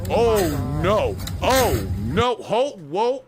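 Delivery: loud, drawn-out oh no; dismayed oh no, swelling loud; aghast reaction. Real, not acted. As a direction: recoil in loud, swooping disbelief.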